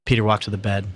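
ordinary delivery

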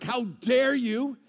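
slow, loud, high mock outrage; indignant retort. Heated text fight with friend, mock outrage. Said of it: "getting really angry".

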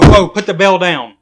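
loud, alarmed whoa, whoa; alarmed shout. Pretend. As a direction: yell to stop him. Stopping cohost ringing bell.

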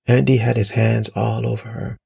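plain reading voice

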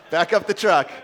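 laughing backpedal. Real, not acted. Backpedalling after joke.

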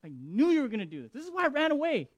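loud, high-pitched acted outrage; angry complaint. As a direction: act out an upset protest at God. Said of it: "but anger. He's upset at God".

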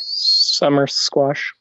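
slow words whistled through the teeth; whistling through teeth. Shown as a demonstration. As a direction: hiss it out like Gopher, very slow. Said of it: "Say, say summer squash that way".

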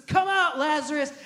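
high, loud summons; commanding call. Retold or imitated. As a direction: call out as if raising the dead. Jesus commanding Lazarus to come out.

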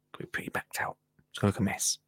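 breathy confidential aside to the viewer; whispered aside. Real, not acted. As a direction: whisper it under your breath. Aside about the planted garden.

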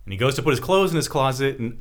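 ordinary talk

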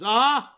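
loud, high mock "uh-huh"; dismissive "uh-huh". Actor on a plane shutting down a fan, loud "uh-huh". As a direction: blurt it to end the conversation. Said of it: "trying to end the conversation".

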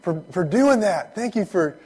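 high, loud gush of gratitude; overwhelmed, grateful cry; loud thanking. Acted. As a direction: pour out thanks to God at full voice. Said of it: "says with a loud voice, he's thanking him".

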